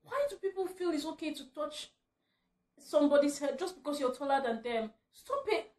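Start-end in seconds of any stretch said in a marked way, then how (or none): 5.1-5.7 exasperated. Real, not acted. Scolding head patters.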